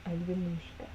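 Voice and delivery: put-on low, soft try at a Russian accent; attempted Russian accent. Practicing the name before mixing accents up, soft Russian accent. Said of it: "then I mix them up".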